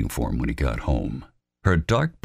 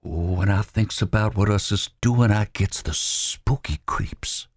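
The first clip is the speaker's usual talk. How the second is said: higher, slowing into a hushed breathy fear; spooked; 5 semitones higher than usual. Acted. Robert Lee carrying the body, spooked and hushed. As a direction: let the creeps creep in.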